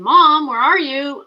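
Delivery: loud, high, drawn-out call; puppy calling for mom. Acted. Puppy waking its owner at six twenty, plaintive loud call. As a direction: wail for mom like a lonely puppy. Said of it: "she woke me up ... six twenty saying".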